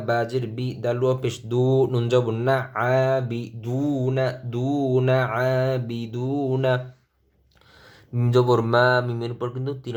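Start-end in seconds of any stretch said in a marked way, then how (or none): none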